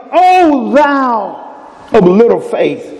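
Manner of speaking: slow, solemn reading of Jesus' words; Jesus' rebuke. Acted. Jesus to Peter sinking in the water, slow and solemn. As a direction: draw it out slowly, a gentle rebuke. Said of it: "called him and said unto him".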